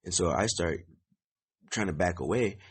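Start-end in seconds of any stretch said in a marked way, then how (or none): none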